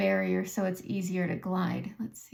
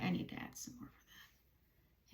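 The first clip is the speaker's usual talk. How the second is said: whispered aside; 12 dB quieter than usual. Real, not acted. Muttered aside while doing makeup.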